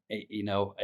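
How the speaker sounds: normal talking voice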